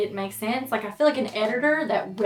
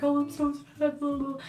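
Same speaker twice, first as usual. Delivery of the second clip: higher, sing-song mock sadness; imitating her fake crying, higher; mocking fake grief; 6 semitones higher than usual. Mocking the girl's fake grief at the funeral.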